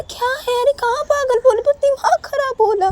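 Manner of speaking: very high, loud protest; exasperated Sanju. Acted. Sanju denying the insult to Uma, high exasperated shout. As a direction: cry out the defence. Acted quarrel, Sanju defends himself.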